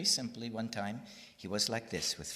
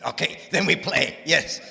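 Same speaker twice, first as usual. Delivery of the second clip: much louder, beaming imitation of Swami; delighted Swami; 6 semitones higher, 13 dB louder than usual. Retelling Swami's delight, loud and beaming. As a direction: burst out happily as Swami. Swami's joyful agreement retold.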